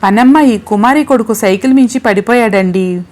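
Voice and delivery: ordinary delivery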